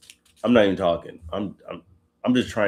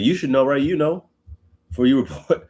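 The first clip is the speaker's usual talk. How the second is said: high mocking taunt, pitched up; sarcastic God; 6 semitones higher, 3 dB louder than usual. God needling Job about his age, mock sarcastic. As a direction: tease him like he should know. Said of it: "he's being sarcastic".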